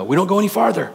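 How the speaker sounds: high-pitched acted refusal; insistent protest. Peter wanting the kingdom right now, insistent. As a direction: dig in, pitch jumping up.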